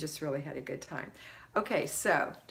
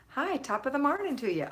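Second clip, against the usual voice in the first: high, louder mock Irish greeting; Irish impression; 8 semitones higher, 5 dB louder than usual. Host greeting a viewer from Northern Ireland, Irish impression. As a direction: put on a playful Irish accent, pitched up. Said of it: "that's my best Irish impression".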